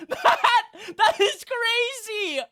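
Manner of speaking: very high, loud, slow laugh-shout; laughing shriek. Real, not acted. Streamer laughing at the result, high shriek. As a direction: laugh it out in shock.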